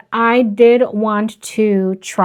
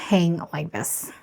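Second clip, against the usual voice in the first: ordinary talk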